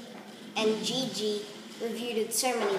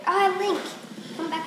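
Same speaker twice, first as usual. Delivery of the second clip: excited shout; 8 semitones higher, 4 dB louder than usual. Real, not acted. Excited call in game.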